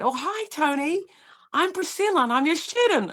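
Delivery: overexcited student meeting her mentor; eager hello. Retold or imitated. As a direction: gush to the new mentor. Eager self-introduction to mentor.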